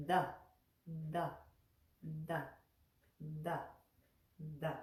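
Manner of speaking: da with the tongue drawn back from the teeth; da with the tongue curled back; retroflex da. Shown as a demonstration. Teacher showing students the retroflex da. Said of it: "You see my tongue?".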